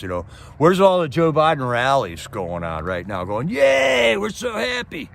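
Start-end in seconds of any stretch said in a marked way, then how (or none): 3.5-5.1 mock cheer. Mocking imagined Biden voter rallies.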